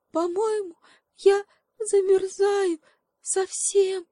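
very high, slow tearful squeak; a frozen little monkey whimpering; sobbing little monkey. Acted. Freezing monkey to the animals, high and whimpering. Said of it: "всхлипнула обезьянка".

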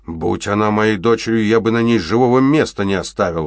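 exploding in anger. A narrator's reading. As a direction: blow up at the girl. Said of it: "взорвался Биглер". (взрывается от злости, громко)

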